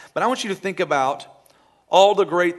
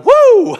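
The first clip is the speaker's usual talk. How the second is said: high loud whoop; excited woo; 14 dB louder than usual. Acted. Congregation member hearing of a million, whooping. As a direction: whoop for joy.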